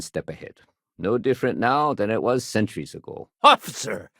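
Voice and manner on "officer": sudden, urgent shout for attention; calling out. Acted. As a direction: call out urgently for an officer. Civilian calling out to officer.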